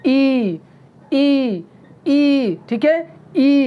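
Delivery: "ई" said with a tight, tensed throat; tense vowel demo. Shown as a demonstration. Teacher showing students a tense vowel, throat tightened. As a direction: squeeze out "ई" with throat muscles strained. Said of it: "इसको इस तरह से pronounce किया जाएगा".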